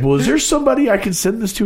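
high, fast imitated caller; old caller voice. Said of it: "and he's like".

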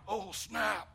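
high, loud mock alarm; hammed-up panicked shout; a churchgoer's loud mock panic. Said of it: "It's easy to be like".

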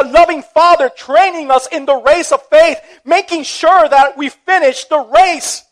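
impassioned preacher's crescendo; full-force preaching. Acted. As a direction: build it into a passionate climax.

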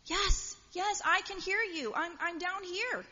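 high, eager call up the cliff; calling back. Acted. Jack answers the voice from above, calling back high. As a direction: call up high so the rescuer hears you.

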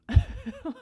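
sudden high bright laugh. Real, not acted. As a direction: burst out laughing at yourself. Amused at her own decision.

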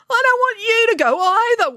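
high loud tearful wail; Binky wailing to Melanie. Acted. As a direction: wail like a big child. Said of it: "wailed Binky".

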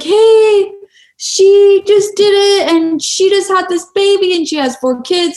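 high, eager praise from the husband; being so nice. Retold or imitated. Husband bragging to bystanders about his wife, nice. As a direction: talk her up to the crowd, sweetly. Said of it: "trying to be so nice".

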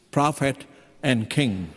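ordinary delivery

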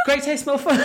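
very high, hurried mock squeak; giggly, squeaky promo patter; flustered slogan. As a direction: rattle it off high, on the edge of laughter. Flustered promoter giving up, laughing.

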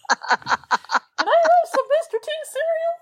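high, slow giddy Pee-wee voice; squeaky Pee-wee line through a laugh. Retold or imitated. Quoting Pee-wee movie lines.

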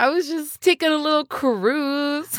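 high-pitched, oblivious joyrider act; mock leisurely pilot. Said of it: "She's just leisurely sailing across the galaxy".

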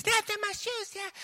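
put-on a little girl correcting the man about her shoes; pouting little girl. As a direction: correct him like an indignant small child. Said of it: "girl replies to the preacher".

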